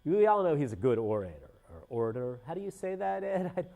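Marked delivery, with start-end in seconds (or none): none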